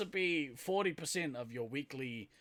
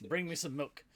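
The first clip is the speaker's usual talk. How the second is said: normal talking voice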